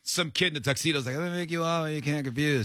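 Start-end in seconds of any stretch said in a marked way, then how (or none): 1.2-2.6 lightly voiced little kid; child voice. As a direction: kid mangling a Godfather line. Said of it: "I was doing an impression of a child".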